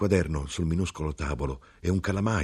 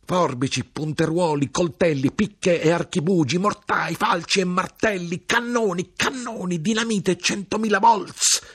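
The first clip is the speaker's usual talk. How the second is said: climbing higher and higher in a frantic list; frenzied rant; 13 semitones higher, 4 dB louder than usual. A narrator's reading. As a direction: build from angry to wild, near-screaming. Grinder rants, escalating list. (elenco furioso che sale sempre più acuto)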